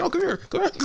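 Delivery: crazed, squealing-high falsetto priest; psychotic priest monster. Retold or imitated. Priest monster with a knife chasing Joe, crazed falsetto. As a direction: coax the victim in a mad, very high voice. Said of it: "just crazy psychotic priest thing".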